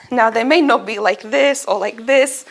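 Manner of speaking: ordinary talk, nothing marked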